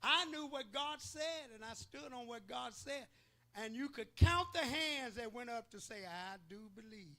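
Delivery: high, loud testimony preaching; fervent preacher building toward a shout. Real, not acted. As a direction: lift the church with your conviction. Preaching at rising pitch.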